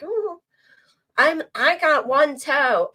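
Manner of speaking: high, slow, whiny boast of an injury; mock the chat's sob story; mock whiny chatter. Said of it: "They're in there like".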